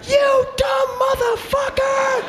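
high, stretched-out distant holler; drawn-out high-pitched angry call; lifeguard yelling. Acted. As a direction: imitate a lifeguard calling across the water.

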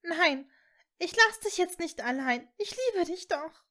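desperate sobbing declaration. A narrator's reading. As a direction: sob out her defiance. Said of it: "schluchzte sie".

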